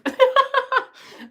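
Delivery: high, loud giggle burst; burst of laughter. Real, not acted. Laughing at own pronunciation.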